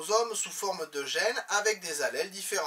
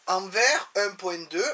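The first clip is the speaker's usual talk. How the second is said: dictating; 5 dB louder, slower than usual. Shown as a demonstration. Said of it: "teacher dictates the course notes".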